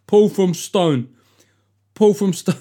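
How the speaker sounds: mocking a name as stupid; mock dim voice. Shown as a demonstration. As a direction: say Paul from Stone like a dimwit. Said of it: "your name suggests that you are".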